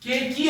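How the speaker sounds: "के की" with an ee sound added at the end; two-syllable misreading. Shown as a demonstration. Teacher supposing the E were sounded, "के की" as two syllables. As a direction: voice the final e of "के की", kay-kee. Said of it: "अगर होता तो ये दो sounds होते".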